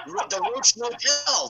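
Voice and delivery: imitating Roddy. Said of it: "he goes".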